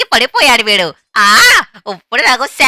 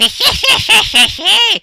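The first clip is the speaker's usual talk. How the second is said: high villain cackle; evil laugh; 11 semitones higher than usual. Acted. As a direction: cackle like a gleeful killer. Killer gloats.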